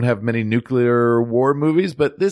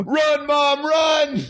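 very high, hammed-up scream to flee; mock panicked shout; much slower than usual. John urging mom to flee, mock panicked shout. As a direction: shriek it like a frightened kid in play. Acted escape from Terminator.

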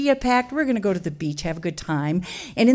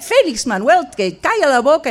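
louder sharp scolding; scolding mother; 5 semitones higher, 5 dB louder than usual. Retold or imitated. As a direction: tell the kid off loudly.

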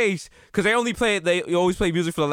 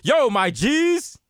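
high, drawn-out mock greeting; hip-hop mayor; 4 semitones higher, much slower than usual. Mayor greets his press conference, hammed-up slangy hail. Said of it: "He is like".

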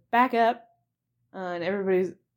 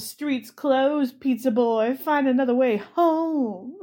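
tough racer; 5 semitones higher, 5 dB louder, slower than usual. Retold or imitated. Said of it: "one of the guys gets out and just goes".

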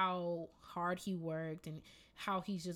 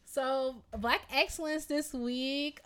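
segment announcement; 7 semitones higher, 6 dB louder, slower than usual. Real, not acted.

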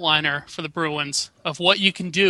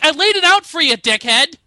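high, loud mock telling-off; exasperated retold scolding; 8 semitones higher, 4 dB louder than usual. Host retelling his reaction to a botched play, exasperated shout.

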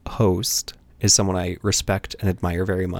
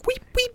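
much higher squeaky weep weep; happy guinea pig squeak. Shown as a demonstration. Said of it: "like a guinea pig when it's really happy".

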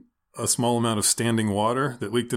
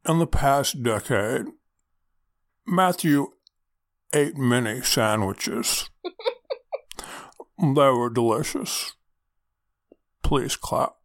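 Garrison Keillor; slower than usual. Acted. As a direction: read it like a folksy public radio host. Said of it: "Garrison Keillor come in and ... dramatically perform".

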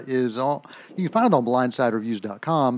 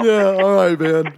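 laughing; 5 semitones higher, 6 dB louder, slower than usual. Real, not acted. Laughing at singing jab.

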